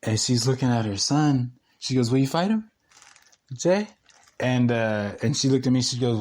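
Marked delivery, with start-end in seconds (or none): 2.1-3.9 high, rising challenge; goading mom. Retold or imitated. Landlord urging her son to fight, goading. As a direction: imitate a mom egging her son on, pitched up.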